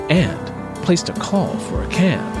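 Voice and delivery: ordinary delivery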